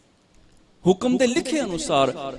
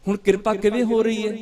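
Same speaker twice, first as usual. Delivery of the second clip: ordinary talk, nothing marked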